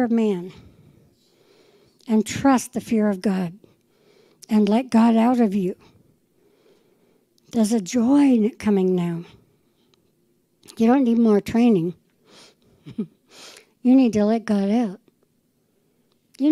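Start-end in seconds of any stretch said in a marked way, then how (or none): none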